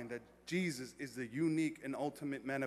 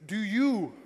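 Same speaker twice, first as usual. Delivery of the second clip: raised, louder wedding officiant voice; officiant's vow; 4 semitones higher, 7 dB louder than usual. Acted. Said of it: "one line that I give to everybody".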